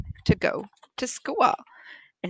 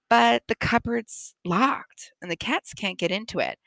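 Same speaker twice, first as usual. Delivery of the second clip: plain reading voice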